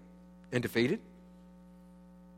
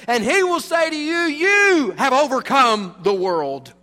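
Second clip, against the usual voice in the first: loud, high, slow proclamation; booming pulpit proclamation; preaching at full force; 10 semitones higher, 11 dB louder, slower than usual. Acted. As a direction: thunder it out from the pulpit. Preacher proclaims God's words.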